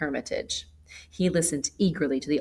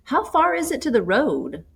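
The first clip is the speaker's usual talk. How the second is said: bright call, much higher and louder; cheerful, persuasive; 10 semitones higher, 8 dB louder than usual. A narrator's reading. Stranger calling out cheerily to the girl in the woods. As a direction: call out cheerily to win her over. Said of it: "called out in a very cheerful and persuasive tone".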